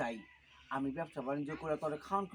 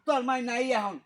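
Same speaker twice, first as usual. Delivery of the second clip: loud, raised-pitch snap; irritated yelling; 5 semitones higher, 7 dB louder than usual. Acted. Father telling son his mother is out, irritated yell. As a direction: shout back in annoyance. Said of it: "তুমি এত চেঁচামেচি করতেছো".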